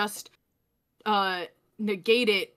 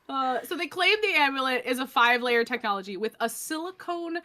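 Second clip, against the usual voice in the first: normal talking voice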